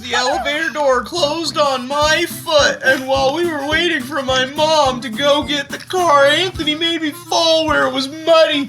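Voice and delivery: put-on a wound-up kid reporting every wrong; upset child voice. Child narrator's woes, voice raised.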